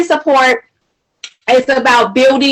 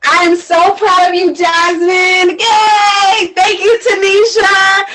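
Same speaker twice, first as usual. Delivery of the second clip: tearful, crying out; 7 semitones higher, slower than usual. Real, not acted. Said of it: "I can't stop crying because I'm so".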